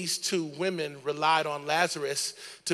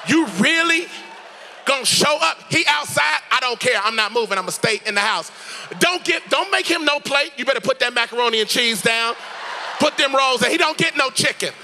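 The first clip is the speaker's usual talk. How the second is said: indignant, higher-pitched sister telling someone off; sassy attitude; 4 semitones higher, 5 dB louder than usual. Acted. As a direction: play an offended sister with loads of sass. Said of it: "She had an attitude".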